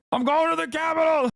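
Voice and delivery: very high joking scream; hammed-up battle cry; mock meltdown. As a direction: yell it like an unhinged fanatic, not seriously. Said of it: "I'm losing my fucking mind a little bit".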